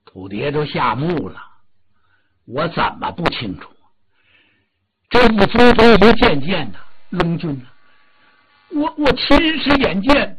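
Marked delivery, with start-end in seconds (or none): 2.5-10.3 rising, louder frightened stammer; frightened Earth God. Acted. The scared Earth God answers the furious Dragon King. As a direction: blurt it out in a panic.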